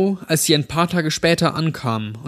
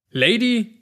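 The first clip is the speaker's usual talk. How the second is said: hoch gebrüllte empörte Frage; wütend zurückgebrüllt; gebrüllt; 9 semitones higher than usual. A narrator's reading. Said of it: "brüllte Rottenbacher". (Rottenbacher brüllt Jack empört an, hoch)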